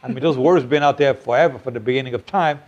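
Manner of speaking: ordinary talk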